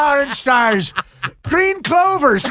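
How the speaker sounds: high, slow mock Irish accent; drawn-out hammy Irish accent. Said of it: "DiCaprio does an accent".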